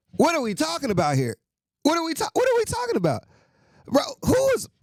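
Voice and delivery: high, fast disbelieving cry; incredulous outburst. Real, not acted. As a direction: squeal in disbelief. Ranting about Kirk Cousins deal.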